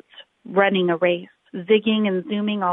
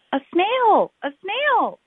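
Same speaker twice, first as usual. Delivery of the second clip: very high, startled cry; shocked teacher; 11 semitones higher, 4 dB louder, slower than usual. Acted. The teacher sees a snail on the board, high startled shriek. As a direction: squeal it up high in alarm.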